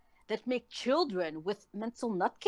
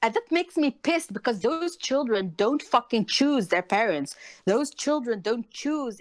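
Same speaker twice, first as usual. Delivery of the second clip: angry outburst; 2 semitones higher, 6 dB louder than usual. Real, not acted. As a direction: spit it out in real anger. Furious rant about abused children.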